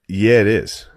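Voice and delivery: loud, defiant comeback; retold mad retort. Passenger answering the airline lady back, retold mad retort. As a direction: throw it back loudly, still worked up. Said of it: "I was so mad".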